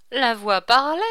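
usual speaking voice

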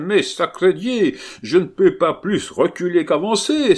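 high, loud outburst; exasperated carter's cry. A narrator's reading. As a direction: swear and protest at full voice. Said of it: "s'écria le charretier".

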